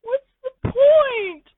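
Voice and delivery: very high, slow wailing complaint; high slow crying whine. Acted. The listener moping alone in a slow high wail. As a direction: whimper it out slowly.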